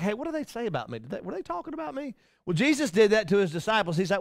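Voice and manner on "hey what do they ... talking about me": a worried half-whisper to a friend; anxious hushed asking. Retold or imitated. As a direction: insecure friend fretting in a hush.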